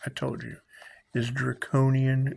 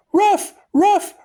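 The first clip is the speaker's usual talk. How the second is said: very high, louder yapping barks; dog bark; 7 dB louder than usual. Retold or imitated. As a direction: yap like a small dog. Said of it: "Imagine a bark".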